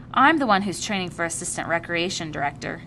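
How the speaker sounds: usual speaking voice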